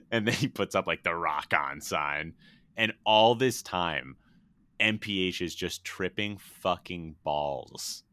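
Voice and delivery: ordinary talk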